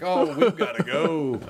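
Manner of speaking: slow, laughing nervous exit. Retold or imitated. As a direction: laugh while backing off. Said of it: "And I was like".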